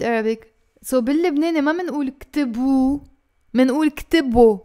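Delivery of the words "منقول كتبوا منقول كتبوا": كتبوا ending in a clear O, ktibo; Lebanese O ending. Shown as a demonstration. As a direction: stress the final O sound in كتبوا. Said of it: "O, with the O sound".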